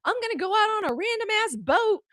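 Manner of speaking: put-on high, eager kid asking mom's permission; a teen girl pleading with her mom, high and eager; my own teen voice to mom. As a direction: pitch it way up like a hopeful teenager. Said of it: "The idea".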